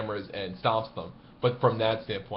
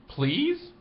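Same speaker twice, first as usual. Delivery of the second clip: much higher, hopeful question; finally getting it, a tentative plea; dawning realisation; 3 dB louder than usual. Retold or imitated. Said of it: "He goes".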